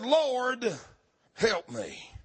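lowly, tender cry for help; humble plea. Shown as a demonstration. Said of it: "You know what the emphasis there is?".

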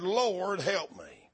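whisper-close humble prayer, falling away; reverent, broken-down plea. Shown as a demonstration. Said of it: "You know what the emphasis there is?".

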